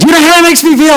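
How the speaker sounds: very high, acted-out thrill; bursting with joy. Girl shares baptism news with teacher, bursting with joy. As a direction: squeal it with excitement, imitating the girl.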